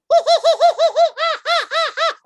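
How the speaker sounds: very high loud squeal; shrill squeal. Retold or imitated. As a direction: squeal like a startled creature. Mock wildlife show squeal.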